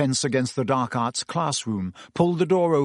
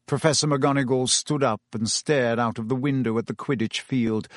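ordinary delivery

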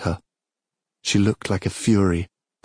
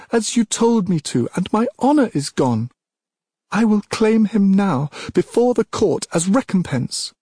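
high, loud anguished fury; furious shout; 13 semitones higher, 4 dB louder than usual. Acted. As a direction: scream out her ruin. Princess rages at goddess.

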